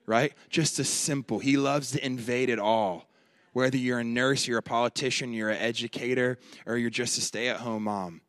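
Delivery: loud, high preacher's cry; preaching at full force. Acted. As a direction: push it out to the whole room. Preaching climax.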